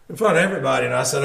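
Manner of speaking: ordinary talk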